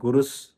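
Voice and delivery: "గురుస్" ending on a bare s; విసర్గం పొల్లు సా. Shown as a demonstration. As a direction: close "గురుస్" on a hissed half-sa, no vowel after it. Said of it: "విసర్గాన్ని పొల్లు సాగా పలకాలి". ("గురుస్" చివర అచ్చు లేని స్ పలకాలి)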